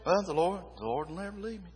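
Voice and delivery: offhand, trailing off softly; careless reading. Shown as a demonstration. Preacher mimicking someone just reading over a verse, careless mumble. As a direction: skim over it as if it means nothing. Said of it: "I can't just read over it and say".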